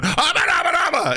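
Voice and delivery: very high babbled mock yell; hammed-up high-pitched blather; mock shouting. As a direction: yell nonsense syllables high, mimicking a shouting actor. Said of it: "all you're doing is just shouting".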